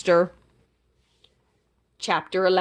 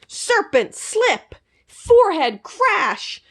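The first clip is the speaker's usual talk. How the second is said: battle cry; 10 semitones higher, slower than usual. Acted. Battle move names.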